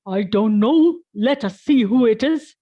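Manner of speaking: loud, booming father bear voice; Papa Bear answers Mama, loud and gruff. Acted. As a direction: boom it out like a big bear. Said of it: "Papa Bear said".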